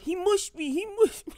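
high, whiny, hurt repetition; hammed-up whine of a man who got shoved; mock whiny JoJo. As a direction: pout it out like a wounded kid. Voicing JoJo pacing upset.

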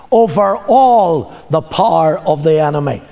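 normal talking voice

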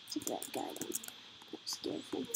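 hushed Gamer whisper, voiceless. Real, not acted. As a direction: whisper it so the guy below won't hear. Sneaking past a threat in game.

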